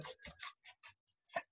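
soft unvoiced tongue clicks; monkey tsk-tsk.

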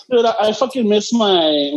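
usual speaking voice

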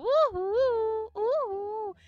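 high whooping cheer. Pretend. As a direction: whoop for joy. Parents said yes.

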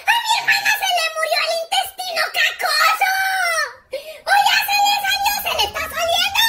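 high, hammed-up hysterical yelling; panicked wailing. Boy alerts class about sister, panicked wail. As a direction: wail for help in mock panic. Brother panics loudly again.

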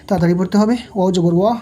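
normal talking voice

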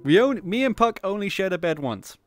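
ordinary delivery

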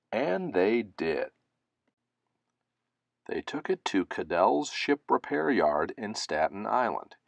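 plain reading voice

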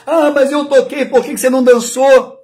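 put-on whining child. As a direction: complain like a hurt kid. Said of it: "ele começa a chorar".

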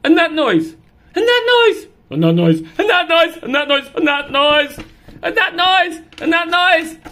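loud, high, jumpy alarm; startled by every noise; alarmed cat. Acted.

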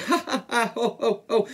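high acted belly laugh; hammed-up elephant guffaw; ho-ho and ha-ha laughter. A narrator's reading. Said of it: "He ho-hoed and ha-ha'd".

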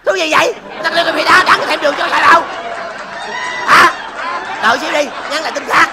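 very loud, high-pitched outburst; angry yelling. Acted. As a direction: yell back at full volume. Customer yells back at café owner.

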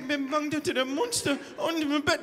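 hammed-up child fear; mock scared child. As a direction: beg dad like a scared kid.

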